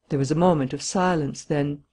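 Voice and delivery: ordinary delivery, nothing marked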